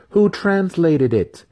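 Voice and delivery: ordinary delivery, nothing marked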